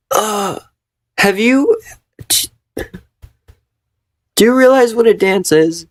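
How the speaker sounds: high, disbelieving question; can't believe what they just read; incredulous. Real, not acted. Incredulous at character.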